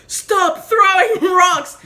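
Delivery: slow, high, loud mock command; acted threatening shout. Chef threatening kids with a big rock, mock shout. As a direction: bellow a drawn-out warning, half amused.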